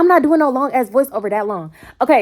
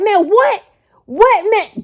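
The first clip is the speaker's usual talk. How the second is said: mock annoyed man; 12 semitones higher, 5 dB louder, much slower than usual. Quoting the angry man.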